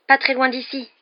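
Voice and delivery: high, loud breaking news; overexcited. A narrator's reading. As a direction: blurt the gossip to friends, thrilled. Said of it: "l'air surexcité".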